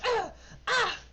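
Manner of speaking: very high loud yelps; pained cries. Acted. Nikki is shot twice, very high yelps. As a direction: cry out as the bolts hit.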